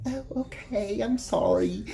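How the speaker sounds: high, small apologetic sister voice. Retold or imitated. Brother imitating sister apologizing, high and meek. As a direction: go way up and sound sheepish.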